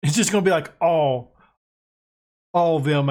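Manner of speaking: ordinary talk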